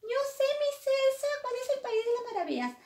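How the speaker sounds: very high, loud puppet-like voice; Paquito. Pretend. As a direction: greet the little friends in a squeaky high pitch. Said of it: "quién es Paquito".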